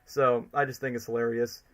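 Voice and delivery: ordinary talk, nothing marked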